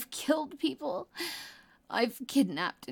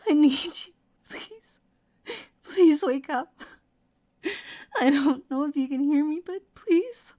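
tearful pleading; 3 semitones higher, 5 dB louder than usual. Acted. Begging a wounded lover to wake up, crying. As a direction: plead through tears, voice climbing high.

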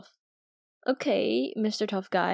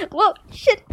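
laughing; 10 semitones higher, 4 dB louder than usual. Acted. Laughing while wrestling.

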